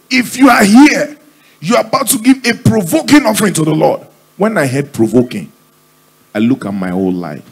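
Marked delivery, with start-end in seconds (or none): 0.1-4.0 very high, ringing preacher's call; preaching pastor. Acted. Pastor calling the church to give an offering, preaching. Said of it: "the pastor said".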